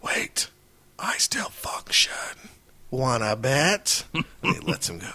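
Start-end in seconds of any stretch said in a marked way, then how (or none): 0.0-2.1 high whispered dying robot voice; slow breathy villain gasp; Starscream impression. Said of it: "doing Starscream impressions". 2.9-4.0 slow menacing taunt; drawn-out sneering question; villain robot voice. Acted. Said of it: "doing Starscream impressions".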